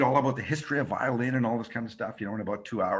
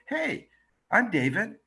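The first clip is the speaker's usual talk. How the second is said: friendly high; 8 semitones higher than usual. Retold or imitated. Greeting homeless couple gently.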